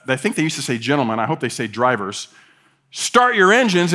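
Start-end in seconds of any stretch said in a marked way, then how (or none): 2.9-3.9 drawn-out, higher announcer call; race announcer. Acted. Announcer to the drivers at the line-up, race announcer. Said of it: "and the announcer says".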